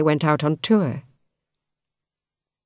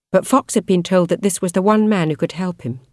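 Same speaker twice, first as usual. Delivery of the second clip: ordinary delivery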